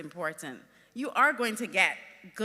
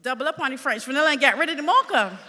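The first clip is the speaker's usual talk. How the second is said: a fed-up boss calling out the fix, fast and loud; a boss calling out her order to the assistant, loud and fast; loud exasperated order; 6 semitones higher, 11 dB louder, faster than usual. Retold or imitated. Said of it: "I said".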